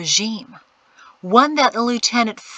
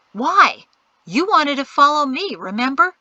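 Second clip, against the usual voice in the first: sharp retort; 7 semitones higher, 7 dB louder than usual. A narrator's reading. Anoki scolds her.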